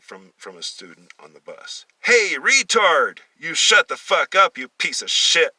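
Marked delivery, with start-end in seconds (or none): 2.0-5.5 loud, high furious shout; shouting back. Acted. Leonard shouting back at a bully on the bus. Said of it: "Leonard shouted back".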